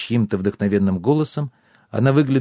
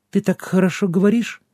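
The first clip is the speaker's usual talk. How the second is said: high, thin, clear little voice; tiny girl's piping voice; thin fairy voice; 9 semitones higher than usual. A narrator's reading. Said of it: "тонким, но отчётливым голоском".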